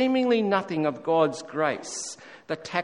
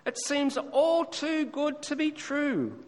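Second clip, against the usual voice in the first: doubting son; 11 semitones higher than usual. Retold or imitated. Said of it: "His son said this".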